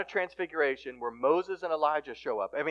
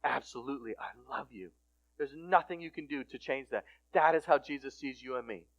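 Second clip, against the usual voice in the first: tender reassurance; 2 semitones lower, 4 dB quieter than usual. Retold or imitated. Dad forgiving his child, tender and soft. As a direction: reassure gently, voice dropped.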